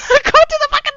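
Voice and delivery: high, loud words through laughter; cracking up. Real, not acted. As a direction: break down laughing mid-attempt. Cracks up attempting the voice.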